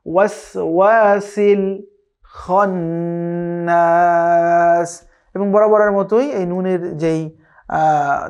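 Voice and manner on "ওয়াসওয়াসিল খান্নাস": drawn-out melodic tajweed chant; slow Quran recitation. Shown as a demonstration. Teacher recites slowly for students to copy. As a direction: recite each syllable long and measured.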